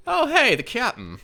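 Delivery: bright high NPC voice; high excited voice. Acted. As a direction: call out high and eager. Voicing NPC, high and loud.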